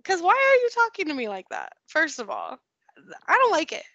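high, mock-indignant sass; sassy comeback. Mom acting out the attitude she gives her kids. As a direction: snap it back at a mouthy kid.

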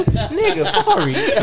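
high, loud laughing shout; calling him out, cracking up. Real, not acted. Laughing call-out to friend.

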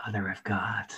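hushed, secretive echo of the line; whispered. Retold or imitated. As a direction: whisper the catchphrase under the breath.

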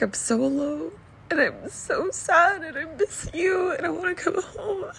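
high, quiet, tearful sobbing quote; imitating son crying. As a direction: voice a crying teen begging to come home. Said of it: "And then he started to cry".

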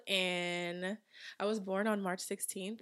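normal talking voice

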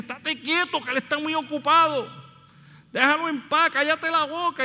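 high, impatient hushing voice; scolding disciples. Retold or imitated. Disciples silencing the blind man, high impatient scolding. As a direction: shush the beggar impatiently. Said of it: "los discípulos ... le dicen".

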